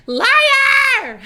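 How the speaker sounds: high, loud shout of liar; mock scream. Viewer mocking the kid's denial, high screamed accusation. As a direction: scream it out, then crack up. Mimics a screamed meme line.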